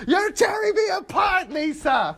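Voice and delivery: high-pitched imitation of an overwrought movie yell; hammed-up, high melodramatic outburst; mock anguished yell. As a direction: cry it out like a hammy actor. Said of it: "right before he yells".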